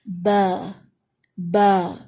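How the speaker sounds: با stretched into a long aa; با neither open nor narrow. Shown as a demonstration. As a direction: stretch با slightly upward. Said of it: "تھوڑا سا stretch کریں گے".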